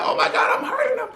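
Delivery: very high squeaky mock wail; hammed-up crying squeal; tearful panic. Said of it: "I start crying 'cause I'm like".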